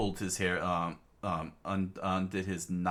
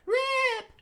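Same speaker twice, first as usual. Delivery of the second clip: piercing high scream of a name; 6 dB louder than usual. Acted. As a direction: scream his name in horror. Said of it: "Latla screamed out".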